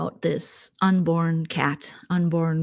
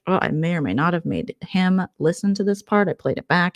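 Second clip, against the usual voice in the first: ordinary talk, nothing marked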